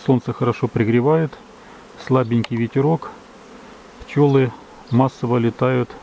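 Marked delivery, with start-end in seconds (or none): none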